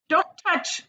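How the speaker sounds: high sharp call of don't touch; shouted warning. A narrator's reading. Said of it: "shouted Mister Wonka".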